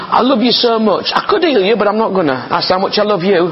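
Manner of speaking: high, sing-song mock sincerity; lightly mocking voice of a reluctant healer; mock sarcastic Jesus. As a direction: send it up like a fake loving promise.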